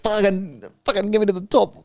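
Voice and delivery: high, desperate plea to be let up; dying creature. Acted. Dying fish begging to reach the top, high and desperate.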